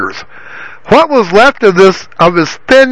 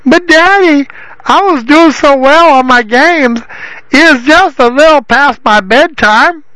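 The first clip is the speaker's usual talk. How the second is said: higher, whining plea to daddy; pleading whiny son; 7 semitones higher than usual. A narrator's reading. Son pleads with father about bedtime, whining higher. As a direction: beg like a spoiled child. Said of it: "pleaded Derek".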